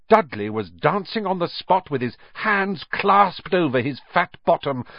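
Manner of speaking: ordinary delivery, nothing marked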